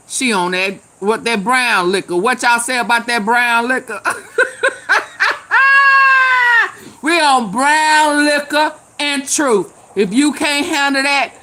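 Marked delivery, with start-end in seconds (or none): 4.0-7.0 high, loud shrieking cackle; tipsy auntie losing it laughing; screaming laugh. Real, not acted. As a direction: throw your head back in a shrill howl. Tipsy host cracking up at chat.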